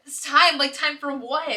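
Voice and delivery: acted loud bewilderment; baffled question. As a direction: blurt it back in confusion. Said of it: "And then I'm like".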